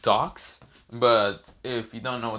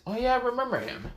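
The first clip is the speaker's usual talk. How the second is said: high-pitched character voice; 13 semitones higher than usual. Acted. Garu voiced in story.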